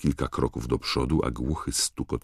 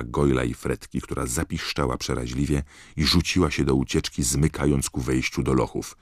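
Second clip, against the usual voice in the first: ordinary delivery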